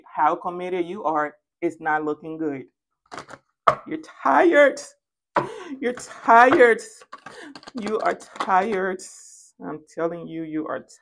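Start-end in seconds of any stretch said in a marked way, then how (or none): 3.9-6.8 high, loud punch on "tired"; mocking, emphatic exclamation; taunting. 7.8-9.0 very slow, drawn-out "tired"; slow, emphatic statement; deliberate taunt. Pretend.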